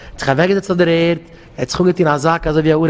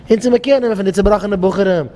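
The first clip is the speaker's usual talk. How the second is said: ordinary talk, nothing marked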